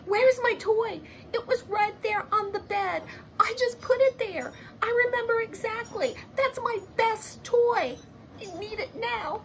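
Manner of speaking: put-on a kid pleading that her best toy was right there; upset little girl. Olivia complaining that her toy vanished, high and upset.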